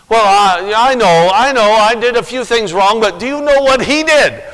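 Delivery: high, loud whiny protest; mock excuse-maker. Preacher voicing a sinner's excuses, whiny and loud. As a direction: whine like someone dodging blame.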